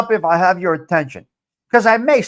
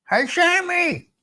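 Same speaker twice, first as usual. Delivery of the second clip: sing-song greeting; 11 semitones higher, slower than usual. Real, not acted. Calling out a greeting.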